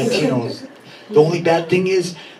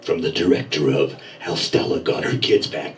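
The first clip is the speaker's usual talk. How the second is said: low and ominous like a horror trailer narrator; movie trailer announcer; 6 semitones lower than usual. Retold or imitated. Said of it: "He'd be like".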